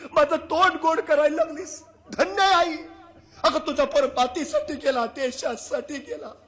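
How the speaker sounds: loud, high, fervent salute; awed praise. Acted. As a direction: bless the mother with all your heart. King praises the mother. (भारावलेल्या राजाची मोठ्याने स्तुती)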